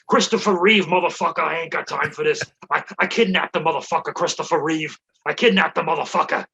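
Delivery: pushed-up mock tough-guy bluster; Joey Diaz. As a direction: rant like a foul-mouthed comic. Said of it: "what Joey Diaz would do".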